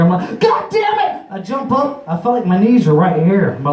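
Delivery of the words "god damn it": very high-pitched startled outburst; acted outburst. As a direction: blurt it out in mock alarm. Acts sudden freakout while tripping.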